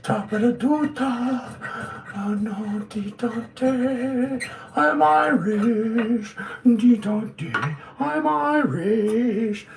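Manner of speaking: high, slow, sing-song mock Irish lilt. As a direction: ham up a playful Irish accent, slow and sung. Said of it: "And they talk like thi".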